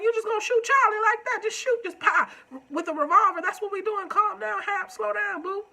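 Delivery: high, loud disbelieving scold; telling him off, outraged; incredulous scolding. Retold or imitated. Scolding a TV character.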